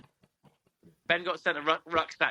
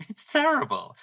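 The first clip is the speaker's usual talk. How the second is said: much higher, squeaked-up dismay; scandalised; 12 semitones higher than usual. Real, not acted. Confessing how horse talk sounds, high-pitched dismay. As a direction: let the voice leap up in horror.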